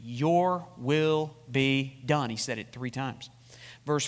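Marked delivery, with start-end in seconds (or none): 0.0-2.3 slow quote. Real, not acted. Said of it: "What were the same words".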